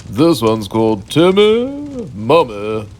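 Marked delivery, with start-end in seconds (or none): none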